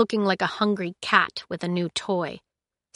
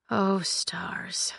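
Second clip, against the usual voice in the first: breathless whisper; murmured; 7 dB quieter than usual. Acted. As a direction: whisper it under your breath. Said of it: "she murmured".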